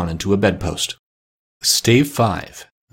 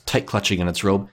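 ordinary delivery, nothing marked

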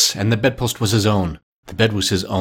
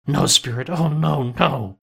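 higher, drawn-out protest; pleading; 5 semitones higher, slower than usual. A narrator's reading. Scrooge refusing his fate at the grave, pleading. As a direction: beg the spirit, pitch lifted.

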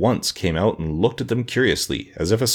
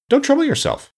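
a much higher, breezy, cheerful brush-off; 3 dB louder than usual. Acted. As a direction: wave him off brightly. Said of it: "said Ginger cheerfully".

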